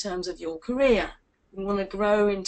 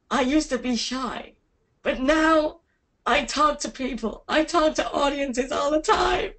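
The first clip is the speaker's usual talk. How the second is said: American accent; 4 semitones higher than usual. Shown as a demonstration. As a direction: cheer it out like a confident American. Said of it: "do it in American accent".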